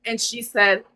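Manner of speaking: ordinary talk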